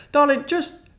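high, loud exasperated begging; pleading deflection. Retold or imitated. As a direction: beg her to drop it. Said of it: "I was like".

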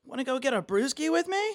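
hammed-up frat boy hollering; frat bro voice. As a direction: greet your buddy like a douchebag frat bro. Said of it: "the frat bro talk".

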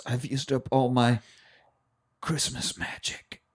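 fading to a slow whisper; mock dying words. Santa using up his magic, fading dying whisper. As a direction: let the voice die away, slowly.

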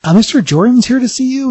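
high-pitched put-on receptionist voice; receptionist on the intercom. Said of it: "the voice comes on and says".

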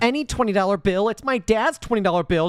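high, mocking whine of a petty man; mock outraged pitch jump; mocking whiny voice. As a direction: ham up his precious-bill whining. Mocking Fraser's outrage over bill.

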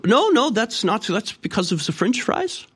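high-pitched mock German accent. German person defending migrants to a sceptical visitor, German accent. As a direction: gush in a squeaky German accent. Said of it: "talking to a German person".

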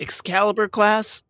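high, incredulous question; disbelieving echo; surprised. Real, not acted.

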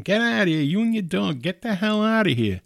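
high-pitched retold yell; imitated yelling. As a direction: imitate someone yelling to chase a man off. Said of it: "he's yelling at him".

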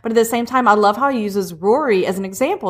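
ordinary delivery

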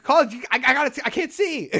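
high, loud, frantic begging; panicked plea. Retold or imitated. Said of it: "I'm like".

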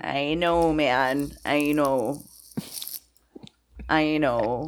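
a hint of a drawling old miner; old prospector. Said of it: "now you're like an old prospector?".

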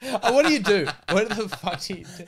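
retold punchline, half-shouted in mock outrage; mock exasperated shout. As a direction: throw the question out in mock disbelief.

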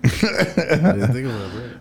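laughing through the words. Real, not acted. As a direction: giggle while admitting it. Laughing at own oversight.

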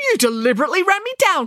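high, indignant whine; hurt, put-upon complaint; complaining headmaster. A narrator's reading. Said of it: "complained Headmaster Pimplestock".

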